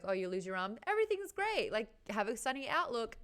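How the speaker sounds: high, chirpy fake positivity; mock cheeriness. Host parodies advice to someone who lost an arm, mock cheery. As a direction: sing out the sunny advice, mockingly.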